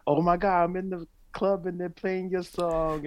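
gushing fan in a club, voice shooting up; excited fan. Retold or imitated. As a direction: fan sending a clip from the club, squealing high with excitement. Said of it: "people send you clips on WhatsApp".

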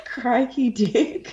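slow, drawn-out Australian "Crikey dick"; exaggerated Aussie accent. Friend mocking the actor's Australian line, in a slow hammed-up Aussie accent. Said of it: "Is that Australian?".

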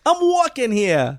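high, slowed New York caricature; New York accent. Acted. Guest tries classic movie line, New York accent. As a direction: holler it like a mock New Yorker. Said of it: "doing a New York accent".

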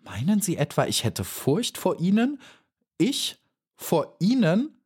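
mock the idea of fear; haughty dragonfly scoffing. A narrator's reading.

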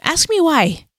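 high, gently prodding voice; Holy Spirit. Retold or imitated. Imitated divine prompt to someone overthinking in prayer, gently prodding. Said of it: "the Holy Spirit's like".

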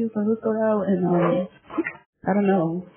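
normal talking voice

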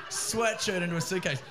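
ordinary delivery

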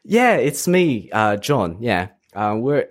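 high, slow, put-on British accent. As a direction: bluff the technician in a bad British accent. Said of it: "in British accent".

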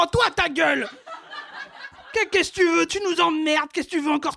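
aggressive mock drunk snarl; belligerent drunk. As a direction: pick a fight with a sneer. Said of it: "j'ai fait parler mes ovaires".

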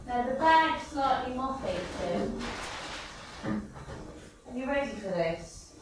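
distant, off-mic voice; talking from the next room; from another room. Real, not acted. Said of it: "I'm in the kitchen".

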